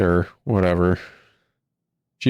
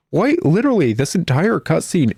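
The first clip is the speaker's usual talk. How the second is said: exasperated outburst; 6 semitones higher than usual. Real, not acted. Ranting about a useless cutscene.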